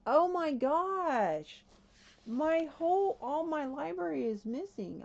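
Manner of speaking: high, loud alarmed outcry; freaking out. Real, not acted. Gamer finds the whole library missing, high panicked outcry. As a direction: cry out in real panic, drawing out the first words. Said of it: "I am freaking out right now".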